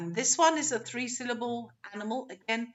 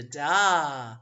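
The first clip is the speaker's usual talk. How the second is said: long, loud "da"; second-syllable stress; 6 semitones lower, 6 dB louder than usual. Shown as a demonstration. Teacher giving the right answer, stressed final syllable. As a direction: lean hard on a drawn-out "da". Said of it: "you would be right if you said".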